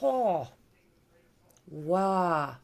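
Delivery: usual speaking voice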